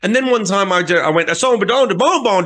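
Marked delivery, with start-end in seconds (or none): 1.3-2.4 lightly voiced, higher singer's gibberish; bouncy nonsense syllables as stage talk; random nonsense patter. Singer slipping nonsense past the crowd, mock patter. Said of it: "Did you just say some random shit".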